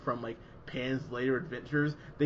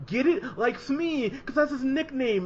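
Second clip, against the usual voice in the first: much higher and louder gloating parody; mocking smug movie; 11 semitones higher, 6 dB louder than usual. Parodying the movie's smug Smee joke, high loud gloating voice. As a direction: shove the pun in the listener's face. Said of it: "It's like".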